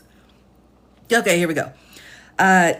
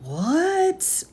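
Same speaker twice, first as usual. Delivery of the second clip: incredulous what; 11 semitones higher than usual. Real, not acted. Baffled rereading the statute quote.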